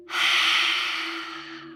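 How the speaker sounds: long whooshing exhale through the mouth; huge sigh. Shown as a demonstration. Guide releasing one last deep breath, huge sigh. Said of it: "release it all out through your mouth with a huge sigh".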